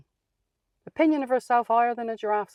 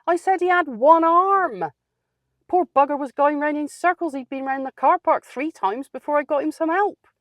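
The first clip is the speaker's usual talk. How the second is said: much higher and louder indignant rant; indignant outburst; 8 semitones higher, 7 dB louder than usual. Retold or imitated. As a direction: blast the manager for his cruelty.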